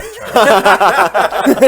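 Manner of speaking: laughing. Real, not acted. Laughing over the story.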